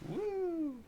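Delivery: sudden high-pitched woo; high whoop. Real, not acted. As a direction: let out a quick whoop.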